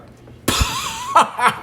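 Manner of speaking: high, loud burst of laughter; explosive laugh. Acted. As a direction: crack up suddenly at the joke. Bursts out laughing at retold joke.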